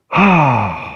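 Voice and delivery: long falling sigh after crying; tearful sigh. Real, not acted. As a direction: let the tears out in a sigh. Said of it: "weepier than I've ever been".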